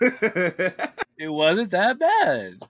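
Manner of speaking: slow, laughing, high and loud. Real, not acted. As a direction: crack up while saying it. Teasing Michael about movie.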